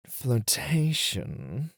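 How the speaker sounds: slow, rising, suggestive flirtation; drawn-out tease. Acted. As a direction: linger on the word teasingly. Teacher revealing lesson.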